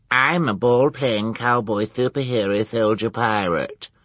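crowing. A narrator's reading. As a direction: crow it like a spoiled girl. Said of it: "she crowed".

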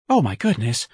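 lightly breathed alarm; breathed exclamation. Acted. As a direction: let it out on a breath. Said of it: "the young man breathed".

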